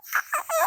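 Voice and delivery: high, gleeful laugh; delighted laugh. Real, not acted. Laughs after proud exclamation.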